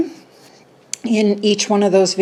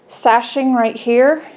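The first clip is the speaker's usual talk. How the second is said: ordinary delivery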